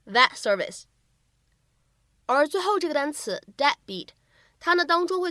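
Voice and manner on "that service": that service with the t unreleased; incomplete plosion. Shown as a demonstration. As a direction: hold back the t in "that service". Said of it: "不完全爆破".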